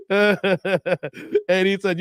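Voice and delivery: ordinary delivery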